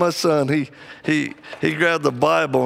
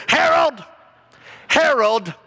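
mock yell; 10 semitones higher, slower than usual. Lady in the men's room calling her husband, high yell. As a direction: call the name out like a frantic wife. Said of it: "she keeps yelling".

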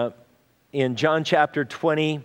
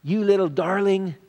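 put-on cooing to a toddler; 3 semitones higher than usual. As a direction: gently coo at a little child held in your arms. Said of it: "take 'em in your arms and go".

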